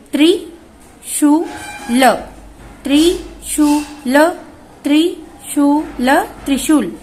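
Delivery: slow syllable by syllable. Shown as a demonstration. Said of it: "आप सभी बच्चे मेरे साथ में बोलते जाइए".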